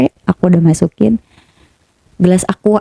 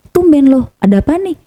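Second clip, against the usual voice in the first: higher-pitched imitation of a curious partner; retold puzzled surprise; 7 semitones higher than usual. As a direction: act out his surprised question, pitched up. Partner surprised by drink suggestion.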